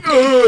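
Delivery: loud, high weird grunt; weird noise. Shown as a demonstration. Showing the noise that annoys his brother, loud and weird. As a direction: blurt a weird high noise at full volume. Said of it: "I can make a weird noise".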